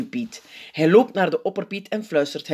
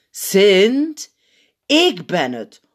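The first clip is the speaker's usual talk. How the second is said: slow, loud address to someone dazed; traag en luid; 5 semitones higher, 6 dB louder, much slower than usual. A narrator's reading. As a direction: spell it out loudly, word by word. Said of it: "hij zegt het traag en luid". (traag en luid, nadrukkelijk)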